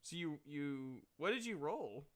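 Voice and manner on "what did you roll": ordinary talk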